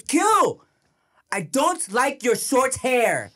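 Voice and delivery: put-on high, slow child voice with emphatic words; imitating bratty little girl. Toddler daughter turns on little brother, imitated bratty high voice. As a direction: turn on him like an indignant toddler. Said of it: "She fucking turns around".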